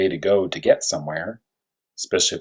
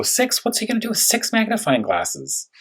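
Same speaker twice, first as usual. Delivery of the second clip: playful bafflement; 13 semitones higher than usual. Acted. Baffled by six magnifiers.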